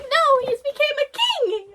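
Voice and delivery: high-pitched delighted character voice; high excited character voice. Retold or imitated. Streamer voicing the character's proud news, high and excited. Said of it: "He's like".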